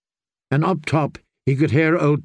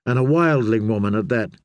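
ordinary delivery, nothing marked

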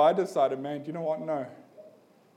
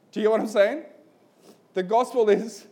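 higher and louder, driving the point home; preaching at full force; 5 semitones higher, 5 dB louder than usual. Real, not acted. Preacher pressing the congregation to respond, in a loud impassioned voice. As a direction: preach it out, rising to the appeal.

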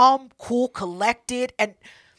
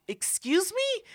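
mock taken aback; 9 semitones higher, 7 dB quieter, slower than usual. Acted reaction of affronted woman.

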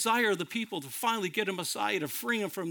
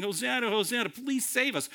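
crowd's pleading shout; 6 semitones higher than usual. Retold or imitated. Crowd hailing Jesus as he rides in, retold pleading shout. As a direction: cry out like a hopeful crowd, half-shouted. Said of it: "The crowd is shouting".

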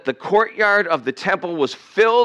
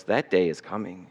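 ordinary talk, nothing marked

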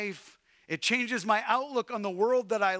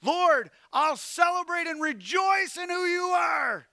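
high, loud shout of praise; a loud, high declaration of praise; joyful shout; 9 semitones higher, 5 dB louder than usual. Acted. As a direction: proclaim it triumphantly. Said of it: "is what he says at the end".

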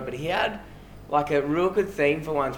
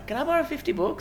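high cheeky mock ask; imitating Legacy. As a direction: pitch it way up like a cheeky mate. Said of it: "And then ten minutes later".

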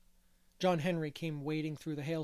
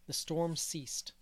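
ordinary delivery, nothing marked

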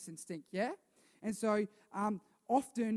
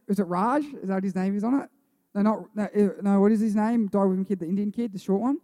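normal talking voice

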